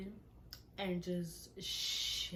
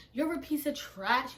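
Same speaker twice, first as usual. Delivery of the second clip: raised-pitch retold telling-off; heated mock insult; cussing out; 5 semitones higher, 3 dB louder than usual. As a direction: act out cussing someone out. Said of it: "straight cussing him out".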